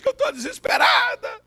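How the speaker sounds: high, hammed-up wail; mock wailing. Pastor mimicking the sobbing girl, mock high wail. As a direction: imitate her crying out in despair. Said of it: "chorando copiosamente".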